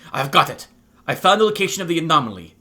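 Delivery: loud burst of triumph; joyful. A narrator's reading. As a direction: announce a find with quick, rising joy. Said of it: "There's joy in her f-, voice".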